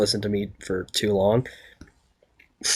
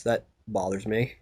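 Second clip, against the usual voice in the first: normal talking voice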